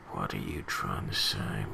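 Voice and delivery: low, quiet mock question to the absent writer; mock deep voice. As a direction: drop the voice way down and put the question to him under your breath. Repeats the question, mock-addressing him.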